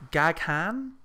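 "Gaghan" as GAG-HAHN, questioning rise; unsure pronunciation. Shown as a demonstration. Host guessing the director's surname, "Gaghan" as an unsure GAG-hahn.

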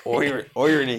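irony in a light Irish accent. Shown as a demonstration. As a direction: attempt irony with Irish vowels. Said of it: "I can't get an Irish one".

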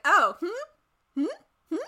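high, loud teasing hums; mock sassy demon. Retelling Meg questioning Sam's brother, sassy hums. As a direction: needle him with mock innocent hums. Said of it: "and she's like".

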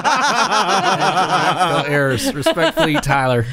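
drawn out, cracking up; laughing through words. Acted. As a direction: read it while giggling. Reading email, laughing.

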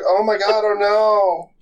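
high, put-on innocent alarm; a high mock gasp of innocence; fake dismay. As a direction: act falsely shocked.

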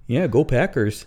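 loud, high mock Wisconsin lilt; sing-song Wisconsin accent. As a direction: cheer it as a hammed-up Wisconsin local. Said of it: "some of 'em have the traditional accent".

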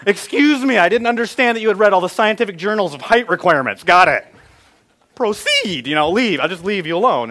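loud, high mock-formal sarcasm; sarcastic dad. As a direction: pretend to defer to a five-year-old expert. Sarcastic reply to child.

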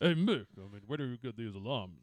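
slurred, dumb boxer voice; Rocky impression. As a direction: play a punch-drunk boxer.

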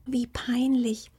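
slow, high, embarrassed whisper. Acted. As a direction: whisper it, mortified. Little fox thinks, embarrassed.

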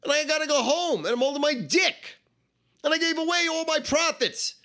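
very high-pitched wail of a ruined gambler; anguished outburst. Acted. Broke gambler bemoaning his lost forty grand, high anguished outburst. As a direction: cry out the losses high and strained. Re-enacts his despair at losing.

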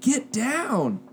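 high-pitched imitated warning yell; mock yell a warning across a gym; high yelled warning, not at full volume; retold yell. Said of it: "and he just yells".

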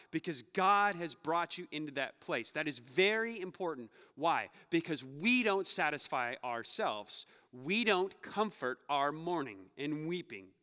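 usual speaking voice

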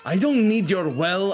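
loud, high, drawn-out protest; indignant refusal. Acted. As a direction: refuse the offer with big indignant emphasis. Rebuffs barkeep's offer of well.

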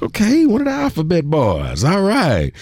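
high, wry okay; teasing reaction to a Fed reveal; mock impressed. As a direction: play it amused and skeptical. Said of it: "I was like".

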